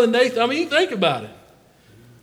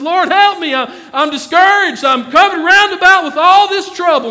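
high, loud desperate plea; pleading Elisha; 7 semitones higher, 6 dB louder than usual. Retold or imitated. Discouraged Elisha begging the Lord for help, loud and high. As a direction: cry out to the Lord in trouble.

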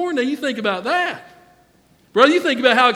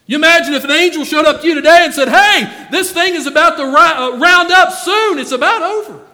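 high, loud, urgent announcing; excited angel call; 5 semitones higher, 6 dB louder than usual. Acted. Angel shouting the good news to a saved believer, loud and high. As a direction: call it out like heaven's herald. Imagined angel calling to the congregation.

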